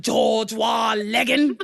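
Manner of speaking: loud, drawn-out mock British shout; hammed-up British declamation; British accent. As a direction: bellow it in a put-on British accent. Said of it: "I do think my British accent is good".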